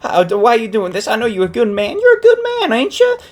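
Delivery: high, frantic mock pleading; pleading grandma. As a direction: beg desperately as a grandma, rising into a squeal. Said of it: "she starts pleading".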